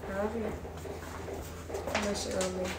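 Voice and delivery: ordinary talk, nothing marked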